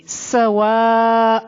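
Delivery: سواء with a long-held aa; long madd. Shown as a demonstration. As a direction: hold the aa of سواء very long on a level note. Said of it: "اس کو خوب کھینچیں گے". (سواء کا الف خوب کھینچ کر)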